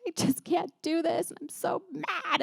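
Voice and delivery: high-pitched, mock tearful wail; imitating tearful venting. Friend venting by video message about her baby, imitated tearful frustration. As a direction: voice climbs as if about to cry. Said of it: "with tears in her eyes".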